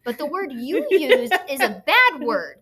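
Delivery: firm teacher. Retold or imitated. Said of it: "And I was like".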